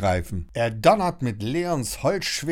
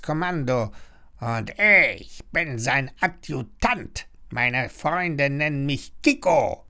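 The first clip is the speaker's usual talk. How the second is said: croaking pirate parrot; 7 semitones higher than usual. A narrator's reading. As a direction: croak it grandly like a pirate bird. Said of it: "krächzt der Papagei".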